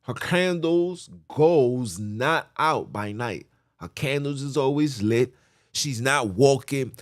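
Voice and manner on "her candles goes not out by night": drawn out, every word weighed; slow emphatic reading. Shown as a demonstration. Preacher repeating the verse to the crowd, slowly. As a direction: lean on it slowly so it sinks in.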